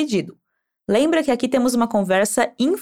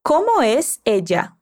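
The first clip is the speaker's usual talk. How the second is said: Como es ella? spoken slowly, words apart; slow model question; slower than usual. Shown as a demonstration. Teacher slowly modelling the question. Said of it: "Assim, repete".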